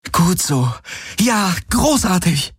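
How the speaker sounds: high, relieved praise; delighted praise. A narrator's reading. Kirai praises her mount for lying down, delighted. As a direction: cheer the animal on, drawing it out.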